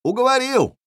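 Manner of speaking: very high, ringing call; high-pitched eager yell; shouted agreement. A narrator's reading. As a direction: shout it across to the enemy. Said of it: "крикнул я".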